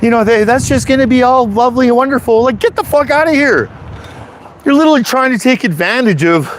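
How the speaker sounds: high-pitched, indignant rant; angry outburst. Real, not acted. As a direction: fire it off in exasperation, pitched high. Ranting at treasonous separatists.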